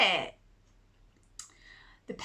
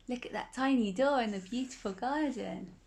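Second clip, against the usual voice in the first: plain reading voice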